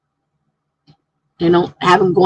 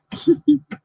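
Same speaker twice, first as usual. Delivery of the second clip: quick high laugh; soft high giggle; short laugh; 9 semitones higher, 5 dB quieter than usual. Real, not acted. Laugh after story.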